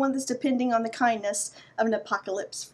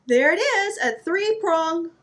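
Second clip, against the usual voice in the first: higher, louder delighted find; triumphant reveal; 5 semitones higher, 4 dB louder, slower than usual. Retold or imitated. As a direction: announce it like a magic trick. Anne produces the adapter.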